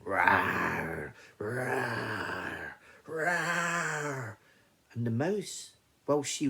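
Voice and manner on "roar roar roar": drawn-out roars climbing in pitch; lion's roar. Acted. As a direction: roar like a trapped lion, thrice. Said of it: "a roaring sound".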